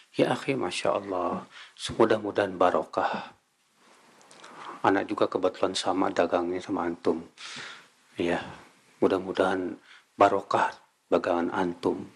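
kind blessing. Retold or imitated.

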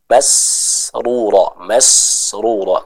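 masrura dengan sin tipis. Shown as a demonstration. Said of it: "Saya contohkan". (sin dibaca tipis)

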